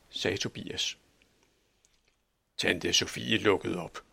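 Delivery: ordinary delivery, nothing marked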